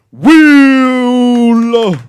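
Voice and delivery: long, loud, high drawn-out name call; host hyping the crowd for Will, loud drawn-out call; announcer call. Real, not acted. Host introducing next comic.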